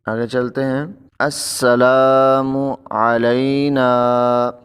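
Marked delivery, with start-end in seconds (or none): 1.2-4.5 السلام علینا drawn out slowly, each sound clear; slow recitation. Shown as a demonstration. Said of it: "تو لوگ پڑھتے ہیں". (آہستہ تجوید کے ساتھ)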